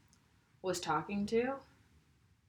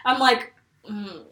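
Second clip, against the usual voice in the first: laughing; 2 semitones higher, 8 dB louder, slower than usual. Real, not acted. Laughing through the story.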